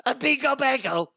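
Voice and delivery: high playful yell; silly shout. Real, not acted. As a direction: blurt it out goofily. Shouted random words.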